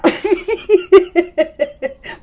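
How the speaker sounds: long high-pitched laugh; helpless giggling; high laughter. Real, not acted. Cracking up at quote.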